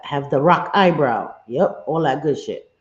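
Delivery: normal talking voice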